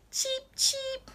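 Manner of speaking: high, tiny cheeping; chick cheeps. Retold or imitated. Reviewing the sounds, high chick cheeps. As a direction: peep like a chick. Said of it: "let's see if I got the sounds right".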